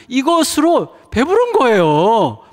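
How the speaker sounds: slow, high and loud, swelling with feeling; overflowing joy. Real, not acted. Father telling the church his heart is full, swelling with joy. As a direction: let the fullness of heart pour out slowly. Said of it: "막 눈물이 나요".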